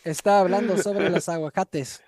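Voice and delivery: mock announcer in Spanish; playful Spanish. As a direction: playfully speak Spanish, loud.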